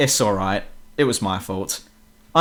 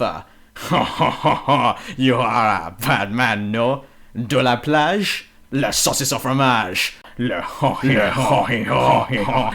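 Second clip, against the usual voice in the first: put-on slow, overdone French accent with hesitant uhs; mock thick French accent; slower than usual. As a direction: ham up a cartoon French accent, dragging the words. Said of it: "The accents are overly thick".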